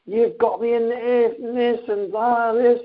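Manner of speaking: high-pitched, worked-up protest; acted-out fed-up outburst; exasperated complaint. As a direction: retell a desperate rant, pitch jumping up. Acted desperate complaint to coach brother.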